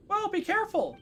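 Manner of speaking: high-pitched companion's cry of caution; worried warning. Acted. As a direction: call out a high, drawn warning. Game companion warns the player.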